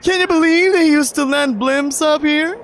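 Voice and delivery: high, loud thrilled shout; a rush of excitement up top; thrilled Mark. Acted.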